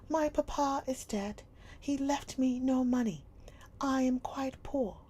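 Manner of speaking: put-on high, loud young girl; a child stating her loss plainly; girl's voice. A narrator's reading. Sarah telling Miss Minchin her papa is dead, high and loud.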